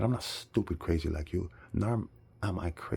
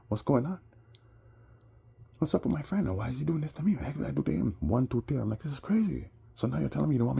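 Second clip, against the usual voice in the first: much higher, exasperated questions; agitated disbelief; 8 semitones higher, faster than usual. Real, not acted. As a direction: pour out the outrage at a friend's betrayal. Upset, recounting betrayal by friend.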